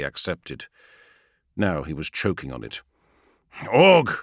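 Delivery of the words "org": a loud, firm call; firmer, louder. Acted. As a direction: call him sharply to attention. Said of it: "firmer, louder".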